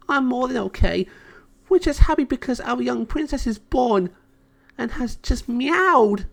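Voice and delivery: overjoyed fairy. Acted. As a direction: still brimming with happy tears. Natasha still overjoyed.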